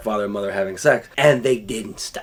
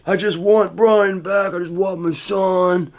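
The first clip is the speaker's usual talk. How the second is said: high, drawn-out pleading wail; hammed-up upset dad on the phone; imitated angry stepfather; 5 semitones higher than usual. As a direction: plead down the phone in a strained put-on voice. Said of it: "this guy was angry".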